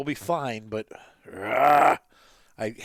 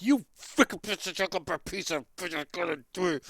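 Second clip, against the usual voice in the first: higher, half-joking cursing at the deer; mock swearing; 7 semitones higher than usual. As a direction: curse out the deer in mock frustration. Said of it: "swearing at the deer".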